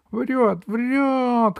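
very high squawked accusation; bird crying out. A narrator's reading. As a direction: cry it from the sky like a bird. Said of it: "крикнула с неба птица Дурында". (пронзительный птичий крик)

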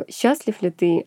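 ordinary delivery

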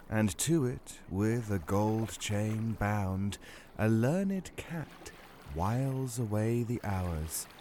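slow, hushed poetic reading; poem recitation. Acted. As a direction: recite it softly like a fairy tale. Reads Pushkin poem.

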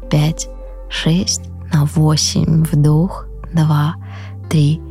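soft, drawn-out counting of breaths; slow breath counting. Acted. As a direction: count the breaths slowly and calmly.

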